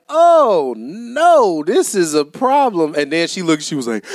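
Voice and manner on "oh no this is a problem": 1 alarmed. Retold or imitated. Realizing the bag is moldy.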